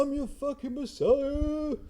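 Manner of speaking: high, slow hammed-up proclamation; mock grand declaration. Mocking John Connor announcing he is the messiah, slow and high.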